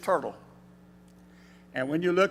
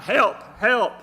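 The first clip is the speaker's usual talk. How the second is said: raised, higher call for help from a cliff; crying for help; 5 semitones higher, 6 dB louder than usual. Retold or imitated. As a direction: call out for help as if hanging over a drop. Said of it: "he cried out".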